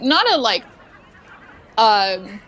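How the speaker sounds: normal talking voice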